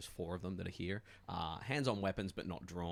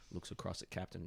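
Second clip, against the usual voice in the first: normal talking voice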